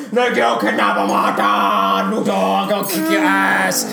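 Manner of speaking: slow, dopey drawn-out hero; mock hero threat. Friend mocking Superman threatening Luthor, slow dopey voice. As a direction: drag it out like a dim hero.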